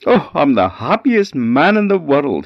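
simple man thrilled with his new cow, delighted; overjoyed. A narrator's reading. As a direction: burst out with delight. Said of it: "Mister Vinegar was so happy".

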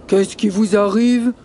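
gruff bear voice; growling bear. Acted. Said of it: "grogne l'ours".

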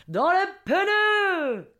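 high, slow southern French holler; hammed-up southern French accent. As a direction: bellow a bad imitation of Marseille accent. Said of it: "Je prends mon plus bel accent du Sud". (faux accent du Sud, crié et traînant)

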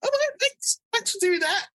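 high, soft mock gratitude; mock thanks. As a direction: thank them in a small, high put-on voice. Acting out patient's ironic thanks.

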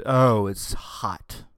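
very slow, dopey mock quote; sarcastic imitation of critics. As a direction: parody a naive argument. Mocking simplistic climate argument.

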